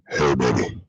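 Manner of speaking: very low, slow seductive crooner voice. Retold or imitated. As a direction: drop deep into a smooth lover's purr.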